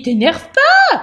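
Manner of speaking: high, loud startled mouse squeak. A narrator's reading. Startled mouse to the little bandit, high and loud. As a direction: protest loudly in a high mouse voice. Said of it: "sursaute la souris".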